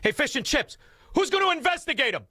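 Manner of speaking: high, taunting call to the Brit; mock jeering at the British guest. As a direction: sing out the jeer high and fast. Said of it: "you with that stupid accent".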